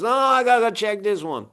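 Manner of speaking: imitated old-timer inspector, pitched high; good old boy. Inspector insists on checking the attic himself, high good old boy voice. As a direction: mimic the veteran inspector in a high voice. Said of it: "Good old boy".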